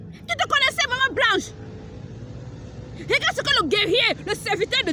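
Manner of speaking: high pitched rebuke. Real, not acted. Prophetess challenging Maman Blanche, high and pressing. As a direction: pitch it up, pressing her with the question.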